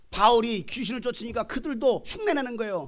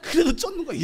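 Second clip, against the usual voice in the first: ordinary talk, nothing marked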